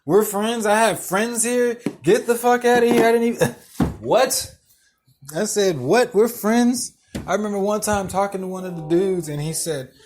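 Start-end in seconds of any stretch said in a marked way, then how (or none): 0.0-7.0 higher, louder, scoffing disbelief; can't believe what you just heard; incredulous mock outburst. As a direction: repeat it back loudly in ridicule. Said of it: "I just bust out laughing".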